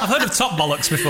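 ordinary talk, nothing marked

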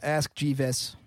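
"Jeeves" slowly, broken into jee-VES; "Jeeves" split as if misread; deliberate mispronunciation. Shown as a demonstration. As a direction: say "ask Jeeves" with Jeeves in two syllables. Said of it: "how do I mispronounce this".

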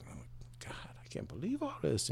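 whispered in disbelief; awed whisper. Acted. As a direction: breathe it out, amazed. Amazed buyer in joke.

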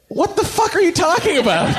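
high-pitched, laughing disbelief; incredulous outburst. Acted. Comic objecting to M's accent claim, incredulous. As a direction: protest as if it's utterly absurd.

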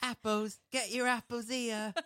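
high-pitched mock cockney street-vendor cry; market seller. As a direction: hawk fruit like a market trader. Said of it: "selling things by the pound".

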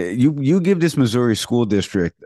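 ordinary talk, nothing marked

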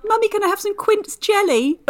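put-on high posh little-girl voice; posh child voice. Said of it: "If I'd have been like".

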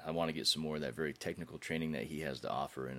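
normal talking voice

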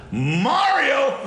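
shouting the name up high and loud; calling out. Retold or imitated. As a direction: bellow for Mario across the room. Fellini calls for his publicist.

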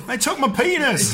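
high, slow hammed-up wail; mock horror victim. Laughing with friends about a horror flat, high slow mock wail. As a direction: play a victim waking up mutilated, wailing. Joining the chopped-up joke.

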